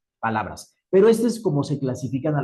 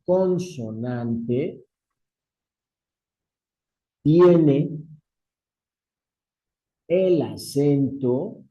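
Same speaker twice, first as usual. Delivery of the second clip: word by word with long pauses; measured, widely spaced phrases; slow dictation; slower than usual. Real, not acted. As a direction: dictate slowly for note-takers. Dictates the first rule.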